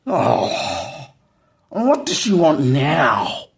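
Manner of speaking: slow, whiny, exasperated groan; annoyed son. Retold or imitated. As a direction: drag it out like a fed-up son. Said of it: "And then Troy goes".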